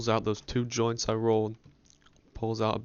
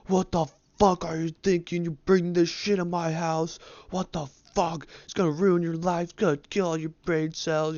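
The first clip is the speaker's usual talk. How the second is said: higher, louder furious scolding; angry dad rant; 8 semitones higher, 4 dB louder than usual. Retold or imitated. Dad confronting his son, a higher, louder furious rant. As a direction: tear into your kid. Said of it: "he's like".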